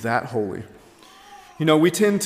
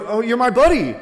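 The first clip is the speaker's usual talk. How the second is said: breezy, over-familiar chumminess, higher pitched; flippant; 7 semitones higher, 4 dB louder than usual. Acted. Someone flippantly greeting God as a buddy, flippant. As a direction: toss it out flippantly, like to a pal. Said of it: "You don't just flippantly".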